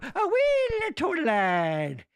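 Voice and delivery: high, slow, crooning wee toad lad voice; silly storyteller voice. Pretend. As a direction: coo it like a Scottish granny. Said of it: "I don't know why I did that voice".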